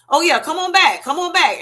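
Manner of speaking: mock welcoming. Mock overly welcoming provider.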